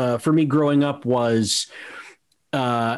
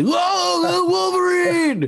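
mock scream, voice pitched way up; imitated street scream. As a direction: holler it like a stranger across the street. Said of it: "had people scream at me".

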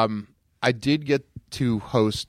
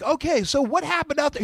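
imitating the interviewer; 13 semitones higher than usual. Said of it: "interviewer is like".

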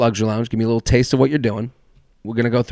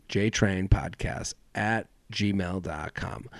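slow, quiet, each part of the email laid out; slow spelled-out address; 3 semitones lower, 10 dB quieter, slower than usual. Shown as a demonstration. Host reading email to listeners, slow and clear. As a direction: read the address slowly so it sticks.

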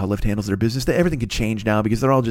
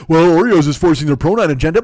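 loud, higher whiny-objector impression; mock complainer; 4 semitones higher, 6 dB louder than usual. Host mimicking an Oreo objector, mock complaint. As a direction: imitate an aggrieved listener, loudly. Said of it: "I know you'll be like".

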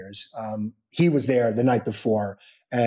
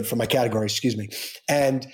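ordinary talk

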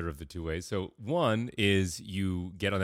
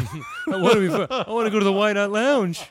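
mock naive kid; 13 semitones higher, 7 dB louder than usual. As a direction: send up a giddy naive kid. Friend mocks young him.

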